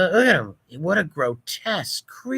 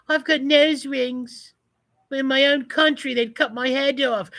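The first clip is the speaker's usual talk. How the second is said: mocking impression; 10 semitones higher than usual. Voicing a protester in a nose ring to mock her, high mock voice. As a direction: lift it up into a silly mock voice.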